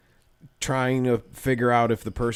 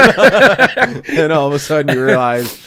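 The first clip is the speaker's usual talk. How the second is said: loud, high, helpless laughing delivery; laughing hard; 5 semitones higher, 12 dB louder, much slower than usual. Real, not acted. Teller landing the forgotten-acquaintance punchline, cracking up. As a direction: push the punchline out through big laughter.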